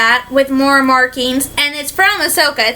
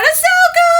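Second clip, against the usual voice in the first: high retold cry of a name; Ezra crying out; 14 semitones higher than usual. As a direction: call out the name in alarm, pitched way up. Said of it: "you hear Ezra cry out".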